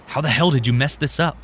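angry. A narrator's reading. Tim blames his cousin.

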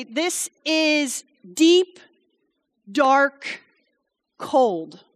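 slow ominous. Acted. Describing inside the fish.

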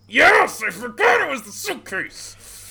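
very high, loud burst of recognition; excited shout. Real, not acted. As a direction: yell it as the memory hits you. Sudden delighted recall of a story detail.

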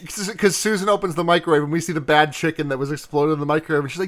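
ordinary delivery

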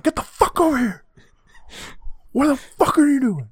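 very high, loud mock screech; furious mom impression. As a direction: scold in a screeching put-on mother's voice. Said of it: "her mom's just like".

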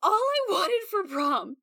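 high, slow sobbing whine; crying. Acted. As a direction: sob it out, half laughing. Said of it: "she's like".

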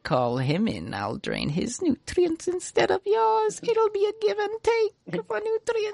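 high, slow, scheming whisper-smooth voice; Littlefinger voice. Retold or imitated. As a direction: purr it like a schemer. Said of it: "the stand gets basically Littlefinger voices".

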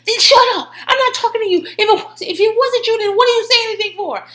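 high, loud angry snap-back; heated, hollered telling-off; yelling. Retold or imitated. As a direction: imitate a man shouting someone down. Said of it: "He's yelling at everyone".

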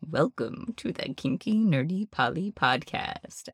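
drawn-out, softly crooned show welcome; mock podcast intro. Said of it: "you already have in the little do".

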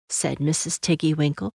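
plain reading voice

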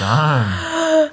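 loud gasp after holding breath; real gasp for breath. Real, not acted. Player relieved after a tense game move, real gasp. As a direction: gasp as if surfacing for air. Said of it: "I haven't breathed in thirty seconds".

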